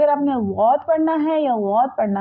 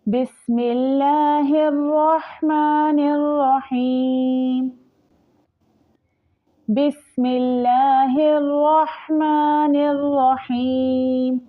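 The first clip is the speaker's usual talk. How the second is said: slow melodic tajweed chant; Quranic recitation; 3 semitones higher, much slower than usual. Shown as a demonstration. Teacher demonstrating the recitation to students, slow melodic chant. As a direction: draw each word out in a measured chant.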